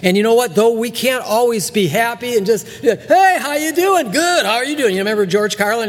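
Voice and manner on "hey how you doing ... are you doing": cheery back-and-forth hello; chipper small-talk greeting. Acted. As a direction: play both sides of a bright hallway greeting. Casual greeting exchange acted out.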